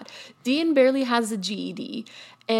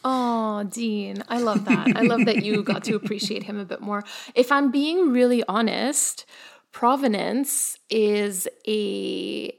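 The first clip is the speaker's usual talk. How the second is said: ordinary delivery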